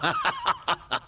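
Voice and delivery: high mocking villain cackle; sneering high laugh of a villain prince. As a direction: cackle with cruel glee.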